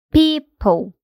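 "people" with PEO- stressed, -ple weak and short; stress on first syllable. Shown as a demonstration. Teacher models "people" for learners, stress on PEO. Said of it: "o stress geralmente tá na primeira sílaba".

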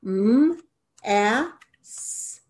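M, a, s as separate letter sounds; sounding out letters. Shown as a demonstration. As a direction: sound out M, a, s slowly for a child. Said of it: "pull the sounds down for each syllable".

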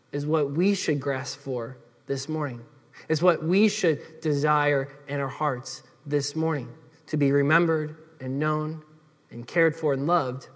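soft, low, heartfelt preaching; hushed, sincere plea with pauses; earnest appeal. Real, not acted.

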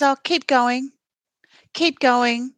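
condescending. Retold or imitated. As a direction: brush her off with mock patience. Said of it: "which is so condescending".

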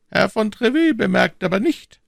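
high, cool and unhurried cardinal; phlegmatic cardinal. A narrator's reading. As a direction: say it with utter composure. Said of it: "mit dem größten Phlegma".

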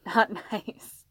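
speaking through a laugh. Acted. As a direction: break up laughing mid-phrase. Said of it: "he laughed".

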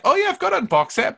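very high, loud mimicry; teasing squeaky-high impression; mocking imitation. Mimicking co-host's casual excuse.

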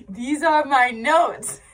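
slow, laughing through the words; giggling as she shows the pile. Real, not acted. Host showing viewers her stack of notes, laughing.

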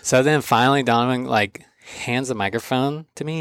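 normal talking voice

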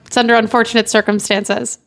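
ordinary delivery

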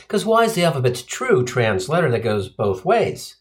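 normal talking voice

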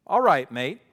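loud mock-British greeting; British accent. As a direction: deliver it as a chirpy Brit would. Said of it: "that's my best British accent".

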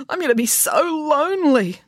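high whiny sob of loneliness; Binky wailing about loneliness; tearful wail. Acted. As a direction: moan it tearfully.

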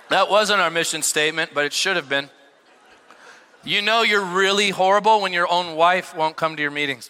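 ordinary talk

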